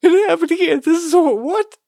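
laughing squeal. Real, not acted. Breaking up laughing at the joke.

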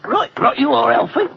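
a poor attempt at Cockney; weak Cockney accent. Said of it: "My Cockney accent doesn't compare with yours".

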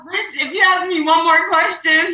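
mock snapping. Friend retelling her own short temper, mock snappy. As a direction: snap back like you've had enough. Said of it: "I'm like".

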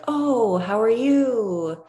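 mock overly sweet small-town welcome; friendly greeting. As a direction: gush a slow, sunny greeting at a neighbour. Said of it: "everybody's still really, like, friendly".

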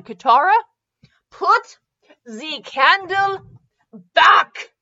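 high, loud, slow call, each word dragged out; shouting from behind. Acted. Edward calling to Katara from behind the wall, loud and slow. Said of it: "Katara shouted from behind".

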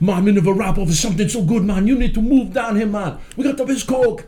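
put-on Caribbean-accented excited quote. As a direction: quote the rapper in his Caribbean lilt while laughing. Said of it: "The guy's like".

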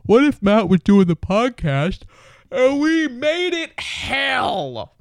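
gleeful saboteur in a put-on high voice; mischievous body. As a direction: climb into a silly high voice, stretching the end. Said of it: "my body was just like".

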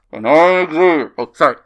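talking through a face mask; muffled by mask. Real, not acted. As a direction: speak muffled behind a mask. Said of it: "Let me take this mask off".

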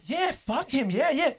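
high-pitched goading with a laugh breaking in; mock egging on. Teller goading a scrawny drunk toward a bouncer, gleefully egging on.

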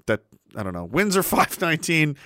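ordinary talk, nothing marked

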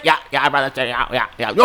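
lightly voiced yeah-man sidekick; other guy agreeing. Second worker answering the hyped dude, lightly voiced agreeing. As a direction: repetitive yeahs, a hint of character. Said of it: "then there's another guy just like".

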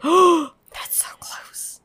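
breathless, whispered gasp of alarm; gasp, then whisper it slowly in awe; startled hushed whisper at nearby news. Real, not acted. Gasping at nearby haunted ranch.

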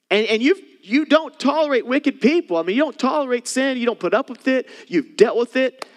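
high, loud, emphatic sermon voice; rising pulpit fervour; fervent preaching. Acted. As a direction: preach it out to the congregation. Preaching at full force.

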